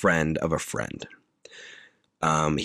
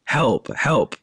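higher-pitched, half-hearted help call; plain mock cry for help; 9 semitones higher, 3 dB louder than usual. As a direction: imitate a badly acted cry for help. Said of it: "she very plainly is like".

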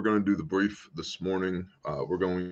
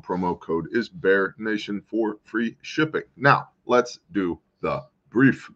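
slow clipped staccato delivery; slower than usual. Real, not acted. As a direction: chop each word apart, slowly. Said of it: "Why are you speaking all staccato".

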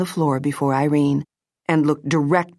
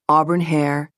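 usual speaking voice

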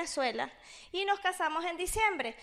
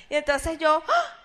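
shocked shriek; 9 dB louder than usual. Retold or imitated. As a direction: yelp like she just caught someone red-handed. Shock on recognizing the voice.

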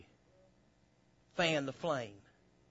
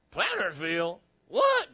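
mock astonishment; 3 dB louder than usual. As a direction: react as if you can't believe it. Acts out friend's reaction to town.

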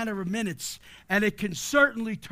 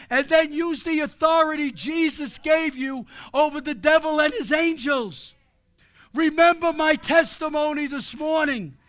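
forceful, declamatory sermon delivery; full-force preaching; 4 semitones higher, 7 dB louder than usual. Real, not acted. Preacher rallying church against the devil, forceful preaching. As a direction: drive each phrase home to the congregation.

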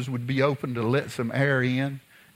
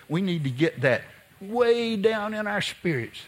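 normal talking voice

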